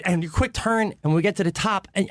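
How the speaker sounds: normal talking voice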